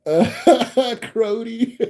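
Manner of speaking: laughing the word out, high. Real, not acted. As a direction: laugh through the word in disbelief. Laughing disbelief at slang word.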